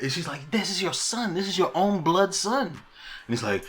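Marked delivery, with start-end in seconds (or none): 0.5-2.7 high-pitched acted protest; protesting mother. Alicent appealing to Viserys about their son, high-pitched protest. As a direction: imitate her urgent appeal, pitched well up. Acting Alicent pleading with her husband.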